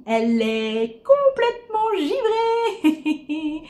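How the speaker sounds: drawn-out slow viewer voice; mock viewer voice. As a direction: say it like a baffled viewer. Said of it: "vous vous seriez dit".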